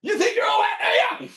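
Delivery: very high, strained distant call; mock taunting shout. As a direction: holler a challenge across the water. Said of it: "he'll just be ... champ going".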